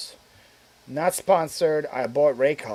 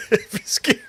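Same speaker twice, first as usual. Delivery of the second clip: high giggling laugh through the words; 10 semitones higher, 4 dB louder, faster than usual. Real, not acted. Streamer cracking up at own joke, laughing. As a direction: laugh it out, amused at the picture.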